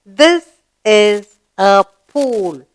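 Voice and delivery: very slow, each word drawn out; slow choral reading. Shown as a demonstration. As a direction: read it along with the class slowly. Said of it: "Now, read the sentence".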